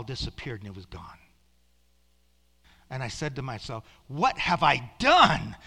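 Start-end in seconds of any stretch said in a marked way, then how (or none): 4.1-5.6 slow, very loud cry of regret; horrified outcry. Retold or imitated. Preacher retelling his dismay as heaven vanished, loud anguished cry. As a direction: belt it out slowly in acted dismay.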